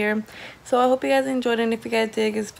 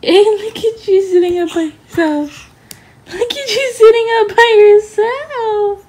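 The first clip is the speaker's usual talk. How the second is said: high, loud, delighted cooing; 9 semitones higher, 7 dB louder than usual. Real, not acted. Parent praising baby for sitting up, high gushing.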